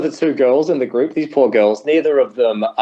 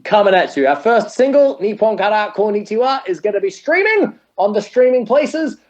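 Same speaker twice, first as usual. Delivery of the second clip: promote the product mode; 4 semitones higher than usual. Shown as a demonstration. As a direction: pitch the single like a radio promo. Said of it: "Can you hear how much my voice changes when I go into promote the product mode?".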